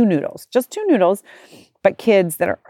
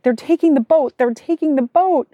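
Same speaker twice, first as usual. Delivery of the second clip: worried daughter; 9 semitones higher than usual. Retold or imitated. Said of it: "Penelope's like".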